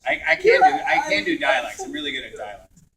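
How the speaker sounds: ordinary talk, nothing marked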